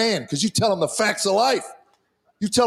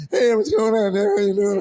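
put-on a touch higher boyish voice, lightly done; voice never dropped; 4 semitones higher than usual. Teen son greets dad in a lightly raised boyish voice. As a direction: lift it a little like a boy's unbroken voice. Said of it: "This man-child's voice never dropped".

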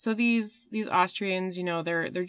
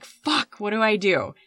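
mock exasperation; 3 semitones higher, 4 dB louder than usual. Overseer stuck between villagers and superiors, mock exasperation. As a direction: act out being stuck for an answer.

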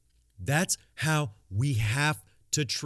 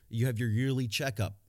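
ordinary talk

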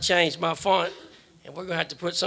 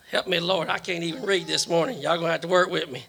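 usual speaking voice

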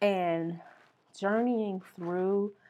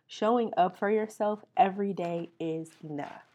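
ordinary delivery

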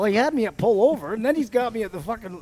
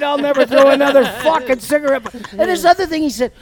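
ordinary delivery

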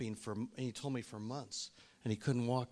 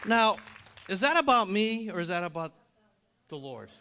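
raised, high preaching voice; impassioned preaching; 8 semitones higher, 13 dB louder than usual. Acted. Preacher questioning congregation about who gets credit, impassioned. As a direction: put the question loudly to the crowd.

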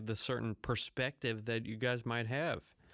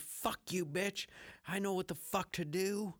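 raised higher, mock-fierce retort; mock angry snap at software; 7 semitones higher than usual. Talking back to his audio program in a higher mock snap. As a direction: cuss out the program, pitched up high. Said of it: "And I said".

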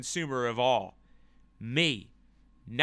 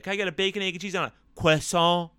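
mock French; 4 semitones higher, 5 dB louder, faster than usual. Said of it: "I'm French American. That's how we say that".

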